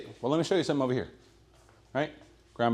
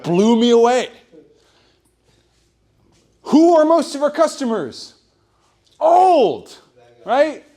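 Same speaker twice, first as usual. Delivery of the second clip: loud excited emphasis; 10 semitones higher, 7 dB louder, slower than usual. Real, not acted.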